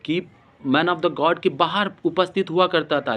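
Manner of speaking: ordinary delivery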